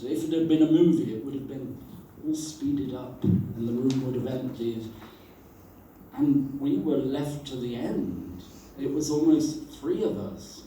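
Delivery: ordinary talk, nothing marked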